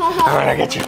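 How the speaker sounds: high, squeaky chasing tease; playful high tease. Pretend. Playful chase threat.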